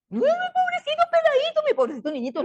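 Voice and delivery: very high, exaggerated cooing; squeaky babying voice; mock pitying parent. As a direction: coo over a poor little kid, mockingly. Mocks parents pitying their little boy.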